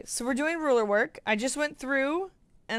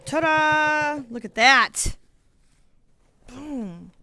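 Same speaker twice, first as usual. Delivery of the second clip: loud, high ta-da; proud reveal; 4 semitones higher, 7 dB louder, much slower than usual. Real, not acted. Vlogger revealing finished quilting to viewers, loudly proud. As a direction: show it off with a flourish.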